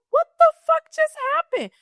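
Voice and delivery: high, loud what the fuck; incredulous high cry; stunned disbelief. Retold or imitated. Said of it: "And I'm just like".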